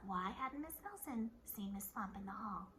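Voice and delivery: plain reading voice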